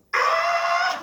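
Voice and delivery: imitated baby shriek. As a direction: belt out a baby's piercing yell. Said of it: "막 이렇게 소리".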